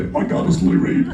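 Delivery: breathy laughing fanboy gush; starstruck fanboy. Retold or imitated. Replaying the [name] moment for the crowd, laughing fanboy gush. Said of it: "fourteen-year-old fanboys".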